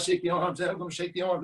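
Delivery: normal talking voice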